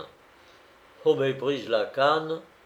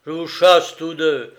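loud, higher angry outburst; furious master; 5 semitones higher, 9 dB louder than usual. A narrator's reading. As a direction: fire them both in a raised voice. Furious master fires both servants.

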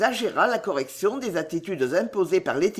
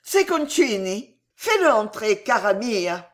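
joyful cry; 4 semitones higher, 3 dB louder than usual. A narrator's reading. Queen hears that Concini has come, joyful cry. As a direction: exclaim it with sudden joy. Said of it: "s'écria joyeusement".

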